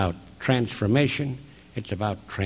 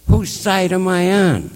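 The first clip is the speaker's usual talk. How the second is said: dawning realisation; 9 semitones higher, 6 dB louder than usual. Retold or imitated. Retold moment of sudden realisation.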